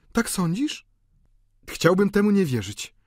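high, questioning female voice; doubting woman. A narrator's reading. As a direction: express reluctance to believe it. Delphine doubts Eugène's suspicion.

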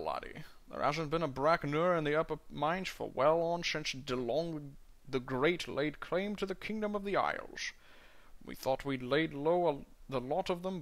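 Scottish burr, rolled and heavy, dwarf in a tavern; a Scottish dwarf doing his best Connery; Sean Connery impression. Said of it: "do a Sean Connery impression".

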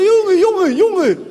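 imitating Moroccan Dutch street slang. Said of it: "Zo heel urban".

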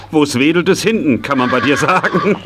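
words half laughed out; laughing while joking. Acted. Man teasing the pony about its shaggy coat, laughing. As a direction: tease the pony through a laugh.

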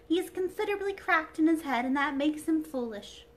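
high, loud dainty princess; a delicate china princess, high and bright; sweet airy princess voice. Acted.

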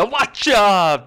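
loud, high mock announcer call; imitated commentator shout. Imitating the call as the RKO lands, excited shout. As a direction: shout it like a ringside warning.

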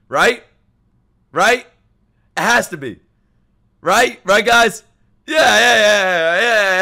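high, loud, urgent self-convincing; insistent pleading. Real, not acted. Streamer begging viewers to back his excuse, insistent. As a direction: press the listeners hard to agree. Desperately seeking the viewers' agreement.

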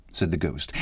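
ordinary delivery, nothing marked

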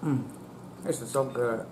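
savoring a bite; talking while chewing. Real, not acted. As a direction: mouth partly full. Seen 1: Holds bitten biscuit; jaw and lips move as if chewing.

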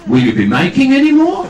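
high, loud mimicked New Zealand question; New Zealand accent. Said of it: "whether I can do the accent".

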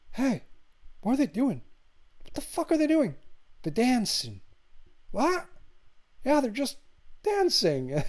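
high-pitched, hammed-up disbelief; squeaky comic incredulity, acted out; mock bewilderment. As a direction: play baffled onlookers, voice swooping up high. Acts out baffled guys inside the house.

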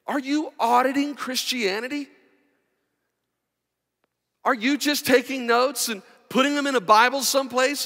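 high, loud, rousing preacher's cry; preaching at full force. Acted. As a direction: build the sermon up to a roar.

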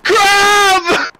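loud excited call; excited shout. Real, not acted. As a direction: cry out with joy. Friend returning on map.

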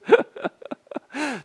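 high, tickled giggle; amused laugh. Real, not acted. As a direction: burst of laughter while watching. Amused by lawmaker's nonstop greetings.

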